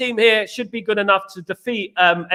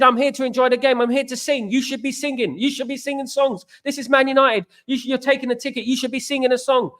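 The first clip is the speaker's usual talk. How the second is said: higher, insistent retold protest; retold telling-off; 5 semitones higher than usual. Fan retelling how he scolded his grumpy seat neighbour, higher insistent protest. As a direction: lecture him like a fan who wants to sing. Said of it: "I said".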